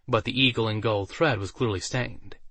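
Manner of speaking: ordinary delivery, nothing marked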